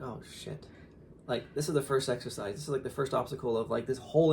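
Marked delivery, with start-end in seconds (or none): 0.0-0.7 under the breath, much softer; softly muttered oh shit; muttered dismay. Retold or imitated. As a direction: drop it to a quiet mutter. Dismay at son's hurt hands.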